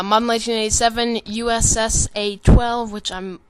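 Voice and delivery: ordinary talk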